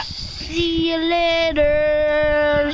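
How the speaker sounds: very slow stretched farewell; drawn-out goodbye. Real, not acted.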